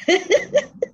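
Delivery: a quick high giggle; short high laugh. Real, not acted. (tawa pendek tinggi)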